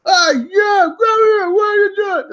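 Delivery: very high mock kid shouting; kids yelling. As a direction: holler like schoolkids calling to each other. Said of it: "They're always yelling at each other".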